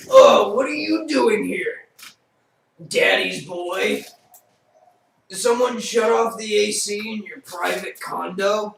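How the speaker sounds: loud and high, booming bodybuilder bravado; big muscle man voice. Acted. Muscle man scoffing at the haunted manor, loud and high. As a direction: boast like a swaggering muscleman.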